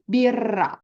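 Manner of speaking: birra with a long trilled double r; long rolled r. Shown as a demonstration. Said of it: "keep the R sound longer".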